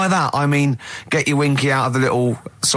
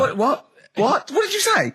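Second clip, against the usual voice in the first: much higher, squeaky mock outrage; mock-outraged question; 12 semitones higher than usual. Host pressing his co-host again, squeaky mock outrage. Repeated teasing question, very high.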